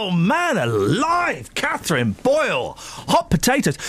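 drawn-out mock swoon; slow, drawn-out exclamation. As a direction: savour it slowly. Host flustered joking compliment.

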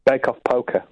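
put-on London voice with OH in "poker"; London accent. Shown as a demonstration. Caller retrying the misheard phrase, in London accent. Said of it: "with my London voice".

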